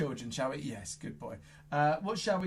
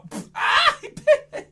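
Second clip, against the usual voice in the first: high, loud laughing squeal; mock bitten; 11 dB louder than usual. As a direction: squeal as if the puppet nipped you. Puppet pretends to bite.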